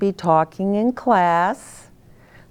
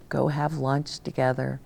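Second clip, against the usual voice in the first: normal talking voice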